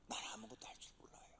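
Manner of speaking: hushed, fast whisper to God; whispered confession. Acted. Believer confessing helplessness to God, fast whisper. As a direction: whisper it as if ashamed.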